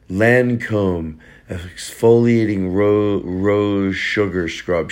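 slow, savoring product name reading; lingering luxury ad read; slow mock-fancy read.

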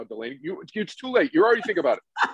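normal talking voice

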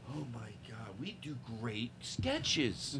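slow unvoiced whisper. Real, not acted. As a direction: whisper it to the room. Whispered aside after the sketch.

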